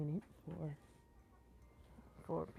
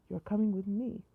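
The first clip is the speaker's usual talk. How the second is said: loud, raised-pitch creepy clown; higher, louder clown voice; 4 semitones higher, 8 dB louder than usual. A narrator's reading. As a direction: pipe it up like a menacing clown. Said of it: "said a high clown-like voice".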